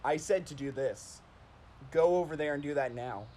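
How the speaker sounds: low, stern dad voice; deep, controlled dad command. Retold or imitated. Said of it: "I tried using my dad voice".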